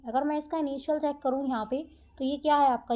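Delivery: usual speaking voice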